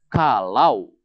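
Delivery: normal talking voice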